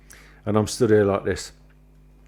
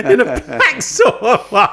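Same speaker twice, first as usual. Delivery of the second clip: high, drawn-out teasing repeat through laughter; mock repeat, high and laughing; laughing echo; 12 semitones higher, 4 dB louder, slower than usual. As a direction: crack up while mimicking the phrase back slowly. Teasing echo of the other's line.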